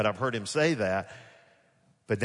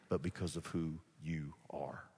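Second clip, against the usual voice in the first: breathy whispered close; 5 semitones lower, 10 dB quieter than usual. Real, not acted. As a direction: let the last words fall to a whisper. Intimate climax of sermon point.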